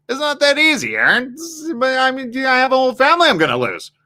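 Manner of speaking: high, pleading, rushed; protesting cheater. Retold or imitated. Cheater protesting to Aaron, high and pleading. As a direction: whine that it's too much to lose. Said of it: "I hear people saying".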